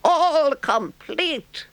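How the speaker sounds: very high, slow, proud announcement; triumphant. A narrator's reading. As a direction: crow it triumphantly. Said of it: "said the toad triumphantly".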